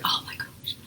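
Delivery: breathless whispered panic; worried whisper. Retold or imitated. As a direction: whisper it like a guilty confession. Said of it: "I felt like I just damaged my kids".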